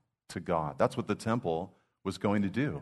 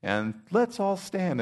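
high, sweet imitation of a gentle worship leader; a lightly voiced soft-spoken woman; little soft voice; 12 semitones higher, 3 dB louder, slower than usual. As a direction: lift into a small sweet welcome, slowing on the last words. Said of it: "in her little soft voice".

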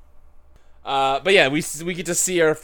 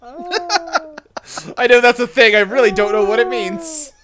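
laughing admission; 6 semitones higher, 4 dB louder, slower than usual. Real, not acted. Laughs at own ignorance.

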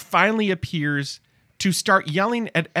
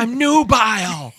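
show-off daughter; 4 semitones higher, 4 dB louder than usual. Acted.

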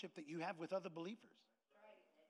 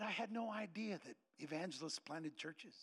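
soft, slow, lowered retold voice; subdued realisation; 4 semitones lower, 6 dB quieter than usual. Wife admits what she learned from the evangelist, subdued. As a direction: let it out quietly and slowly.

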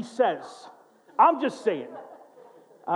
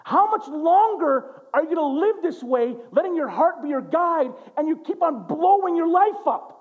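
urgent plea; 7 semitones higher, 4 dB louder than usual. Retold or imitated. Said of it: "He's saying".

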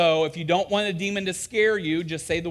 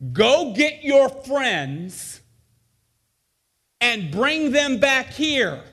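slow instructions; 8 semitones higher, 5 dB louder, much slower than usual. Retold or imitated. Instructions to camper.

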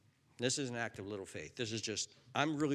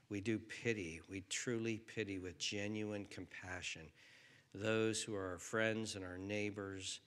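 lower, soft closing prayer; 5 semitones lower, 5 dB quieter than usual. Real, not acted. Pastor praying with congregation, soft and sincere. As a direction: lead them in prayer gently.

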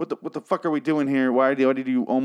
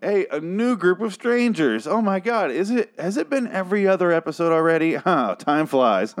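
sarcastic; 7 semitones higher, 3 dB louder than usual. Retold or imitated. Viewer mocking yet another new group, high sarcastic cheer. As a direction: gush with heavy sarcasm. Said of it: "and I'm like".

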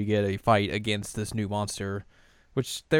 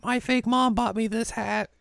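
put-on high, slow little-girl voice; childlike Chibiusa voicing; childish Chibiusa; 13 semitones higher, slower than usual. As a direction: play a small wide-eyed kid. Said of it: "And she's like".

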